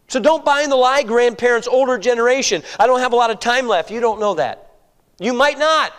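usual speaking voice

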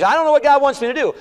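high, loud, fast whiny excuse; mock complaint. Preacher mocking believers who claim not to know, high whine. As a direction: mimic the lame excuse.

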